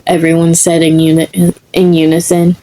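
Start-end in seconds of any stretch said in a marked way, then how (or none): none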